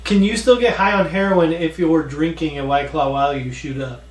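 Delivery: normal talking voice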